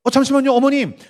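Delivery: a hint of a doctor halting a delivery; lightly voiced doctor. Obstetrician stops mother during birth, politely urgent. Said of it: "산부인과 의사가".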